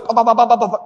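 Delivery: quick tat tat tat, like keys; tat tat typing noise. Retold or imitated. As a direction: rattle it off like a typewriter. Imitating words pouring out fast. (bunyi ketikan cepat)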